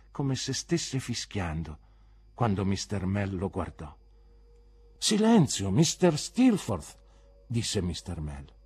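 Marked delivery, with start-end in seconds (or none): 5.0-7.0 high, loud, slowing on the name; sharp teacher's command; stern reprimand. Acted. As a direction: call a defiant pupil to order. Teacher orders pupil to be silent.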